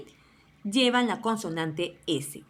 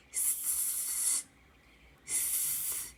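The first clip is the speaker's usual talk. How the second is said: sustained unvoiced sss; long S hiss; 17 dB quieter than usual. Shown as a demonstration. Teacher shows how letter S sounds, long serpent hiss. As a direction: hold a snake-like sss. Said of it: "La letra S o consonante S suena".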